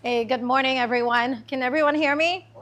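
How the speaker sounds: normal talking voice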